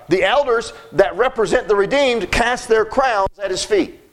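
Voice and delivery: ordinary talk, nothing marked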